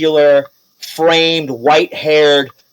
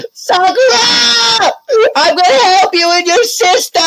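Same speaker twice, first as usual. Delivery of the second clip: heroic cry; 4 dB louder than usual. Acted. Pete shouting to Sakura, high and loud. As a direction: yell her name across the battlefield. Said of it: "he's like".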